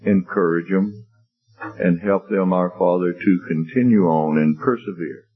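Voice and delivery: low, measured congregational prayer. Real, not acted. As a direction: earnestly address God with long pauses. Leads closing prayer.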